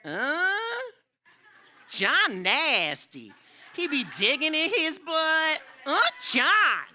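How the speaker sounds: high, slow, loud mocking schoolgirl voice; imitating a classmate. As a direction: drag it out like a kid tattling in class. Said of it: "she said this".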